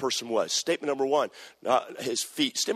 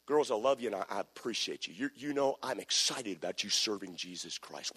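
softer and lower, gentle praise; tender encouragement; 6 semitones lower, 7 dB quieter than usual. Retold or imitated. Pastor praising the girls, soft and low. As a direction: hush it down like a kind word. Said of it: "I don't have a problem with doing that".

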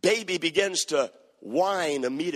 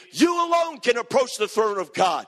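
full-force preaching; 6 semitones higher than usual. Acted. Preacher urging the congregation to rise up and serve God, impassioned preaching.

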